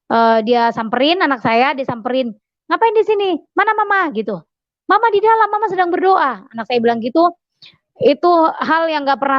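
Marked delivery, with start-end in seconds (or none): 2.7-4.1 put-on high, pressing questions to the child; questioning husband. 4.9-6.4 put-on high, innocent child's voice; child's answer. Child answers her father.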